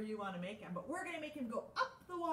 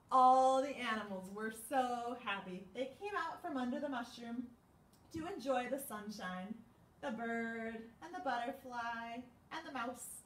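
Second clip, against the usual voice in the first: usual speaking voice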